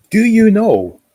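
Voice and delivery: high and loud, bursting out; sudden excited shout of do you know; excited outburst. Real, not acted. Excited call to viewer.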